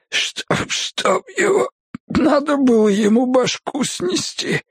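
high, strained voice choking for air; gasping in agony. A narrator's reading. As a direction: wheeze and break off, fighting for breath. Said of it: "Он мучительно застонал, хватая ртом воздух". (задыхаясь, мучительно хрипя)

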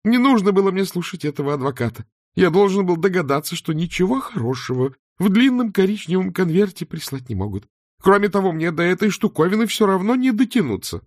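high-pitched complaining character voice; Arthur the grumbling count, indignant. Acted. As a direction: rant in a high, aggrieved voice. (высокий возмущённый голос графа)

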